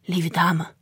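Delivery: a lightly voiced, low-key grumble; slight growl of a grumbly old man; grumbling grandpa. A narrator's reading. Grandpa addressing a lady customer, grumbling mildly. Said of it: "bromde hij".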